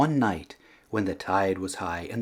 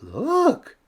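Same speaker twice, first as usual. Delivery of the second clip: much higher excited call; children spotting the snail; 4 dB louder than usual. A narrator's reading. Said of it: "say the children".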